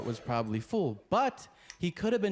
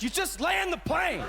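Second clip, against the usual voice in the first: angry passenger yelling at the pilot; more aggressive. Retold or imitated. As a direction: bark the order at him, furious. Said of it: "more aggressive".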